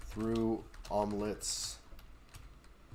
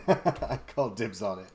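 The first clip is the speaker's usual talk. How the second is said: laughing; 7 dB louder than usual. Real, not acted. Laughing while claiming dibs.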